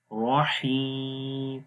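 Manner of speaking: very slow رحیم with silent final meem; stop on meem. Shown as a demonstration. Teacher showing the stop at the verse end. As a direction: stretch رحیم and stop dead. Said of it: "اس کو پڑھیں گے رحیم".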